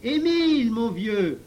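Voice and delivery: high, loud yell to a friend; shouted call. A narrator's reading. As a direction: call out at the top of your voice. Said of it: "en lui criant".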